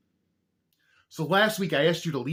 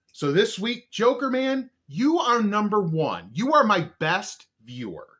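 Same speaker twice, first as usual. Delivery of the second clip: announcer voice; 8 semitones higher, 6 dB louder than usual. Acted. Crowning top viewer.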